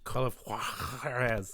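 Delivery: slow, throaty JUAREZ; "Juarez" as a long, hammed-up throat noise; mock guttural title. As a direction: drag out "Juarez" with a rasp at the front. Echoing and exaggerating the title.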